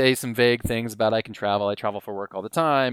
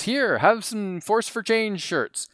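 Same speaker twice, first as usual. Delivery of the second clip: slow, higher, lightly voiced D C; imitating D C; 8 semitones higher, much slower than usual. Friend handing shirts to kids, light mock D C. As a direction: hand them over slowly in a pitched-up D C voice. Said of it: "and says".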